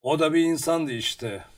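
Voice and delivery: understated satisfaction in a lifted voice; openly pleased. A narrator's reading. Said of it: "sevindiği açıkça belli olan bir sesle".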